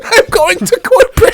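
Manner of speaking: high, laughing mock threat; laughing high mock complaint; laughing echo. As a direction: repeat it cracking up. Echoing quote, laughing high.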